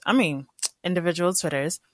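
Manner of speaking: ordinary delivery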